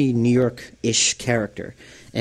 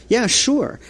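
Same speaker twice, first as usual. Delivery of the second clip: high, casual agreeing yeah sure; breezy yeah sure; 14 semitones higher than usual. Retold or imitated. Agreeing to the artist's picture, light and high-pitched. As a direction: toss off a carefree yes. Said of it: "And I said".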